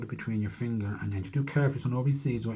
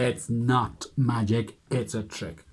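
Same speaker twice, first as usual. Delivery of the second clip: ordinary talk, nothing marked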